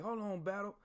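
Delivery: playfully tag in like a sergeant hungry to smoke recruits; drill sergeant cutting in. Retold or imitated. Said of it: "One drill sergeant was like".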